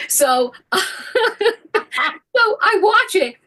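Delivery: high, slow laughing retelling. Real, not acted. Telling the host about watching the trade drop, laughing. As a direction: laugh through it.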